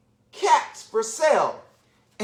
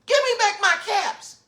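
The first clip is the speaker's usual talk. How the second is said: mock scream; 11 semitones higher than usual. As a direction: mildly act out hollering at monkeys. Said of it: "he keeps screaming at the monkeys".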